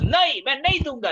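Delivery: high, loud, fast flat no; curt refusal. Shown as a demonstration. Refusing help with a closed, loud no. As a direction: snap the refusal shut. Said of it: "This is a negative gesture".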